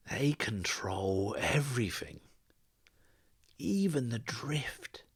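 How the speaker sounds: slow secretive whisper; conspiratorial whisper. Acted. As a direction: lean in and breathe the secret slowly. Silver leans close, confides secret.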